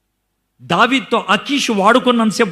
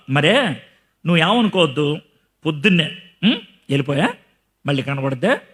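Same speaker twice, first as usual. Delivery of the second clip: soft, lower, mock-polite brush-off; sarcastic politeness; 6 semitones lower, 5 dB quieter than usual. King politely sending David away, softly sarcastic. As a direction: dismiss him sweetly with a sneer underneath. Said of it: "ఎంత ఎటకారంగా".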